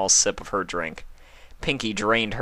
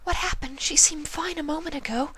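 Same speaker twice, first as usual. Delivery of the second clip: high, breathy whispered pony voice; Fluttershy to friends, a high timid whisper. A narrator's reading. High timid whispering pony.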